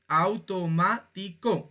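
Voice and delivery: "Automático" split slowly into au-to-má-ti-co; slow classroom syllable count on "Automático". Shown as a demonstration. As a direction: sound out "Automático" one syllable at a time. Teacher splits the word into syllables.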